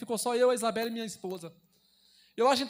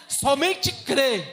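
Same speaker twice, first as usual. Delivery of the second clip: very slow and louder, each syllable of "Somente crê" weighted; slow emphatic plea; 2 semitones higher, 6 dB louder, much slower than usual. Acted. Pastor urging the church to only believe, slow and emphatic. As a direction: draw out "Somente crê" slowly and firmly. Climax of sermon exhortation.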